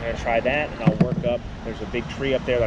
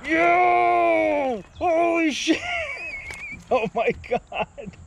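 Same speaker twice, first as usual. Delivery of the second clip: ecstatic shouting; much slower than usual. Real, not acted. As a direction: scream with joy at the catch. Landing the giant pickerel.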